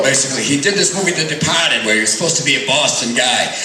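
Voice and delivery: ordinary delivery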